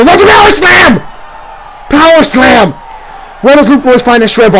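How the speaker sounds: amazed by the comeback; yelling the power move; excited shout. Acted. Sudden power slam comeback.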